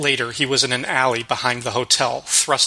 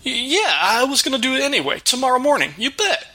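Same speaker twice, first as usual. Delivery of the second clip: much higher, nervous babbling cook; stammering fat cook; 12 semitones higher than usual. Acted. Frightened cook confessing tax cheating to the Batman, high nervous stammer. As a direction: blurt out excuses, caught red-handed.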